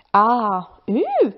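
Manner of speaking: put-on a child's wondering discovery; curious gasp. A narrator's reading. Child spotting marks in the snow, curious ooh.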